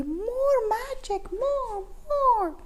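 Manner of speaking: high, eager demanding cry; excited. Acted. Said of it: "the cats cried excitedly".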